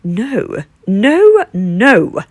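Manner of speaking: loud wailing no from the bear; wailed. A narrator's reading. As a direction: bear wails its refusal. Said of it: "wailed the big bear".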